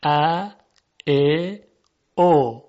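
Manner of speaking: "ا، ا، او" as separate Persian a, e, o; Persian short vowels. Shown as a demonstration. Teacher listing Persian vowels, "ا، ا، او" spaced apart. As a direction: say "ا، ا، او" one by one as a, e, o. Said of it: "در زبان فارسی".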